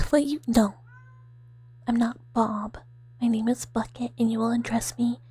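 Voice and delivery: ordinary delivery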